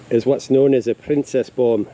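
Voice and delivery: ordinary talk, nothing marked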